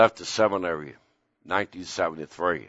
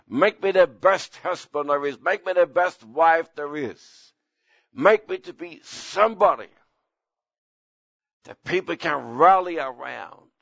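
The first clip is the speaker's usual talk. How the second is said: fervent prayer; 7 semitones higher than usual. Acted. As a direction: lift it up like a prayer over the flock. Preacher praying and exhorting.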